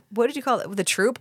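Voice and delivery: ordinary delivery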